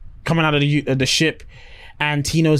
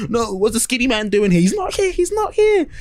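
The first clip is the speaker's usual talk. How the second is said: high, rising mock panic; frantic denial; 8 semitones higher than usual. Everyone's going crazy over Skinny Man, frantic denial. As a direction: insist in a squealing fluster.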